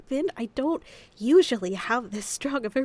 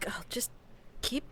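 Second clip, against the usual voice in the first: normal talking voice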